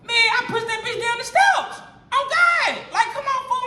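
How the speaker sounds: high-pitched, worked-up exclamation; exasperated outburst. Retold or imitated. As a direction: retell the breaking point with a jump in pitch. Climax of retold fight.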